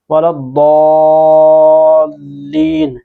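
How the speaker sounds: वलद्दॉलीन with a thick, rounded "daw", held very long; slow tajweed recitation, stretching वलद्दॉलीन; heavy Dad. Shown as a demonstration. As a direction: chant वलद्दॉलीन slowly with a full-mouthed Dad. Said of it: "दौद को किस तरह मोटा पढ़ना है".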